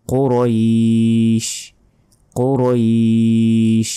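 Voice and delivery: কুরাইশ as "qo-roeeesh", ee held very long; slow chant of কুরাইশ with heavy qo; final elongation. Shown as a demonstration. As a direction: stretch the end of কুরাইশ before sh. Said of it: "শেষে টান হবে".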